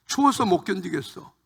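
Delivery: usual speaking voice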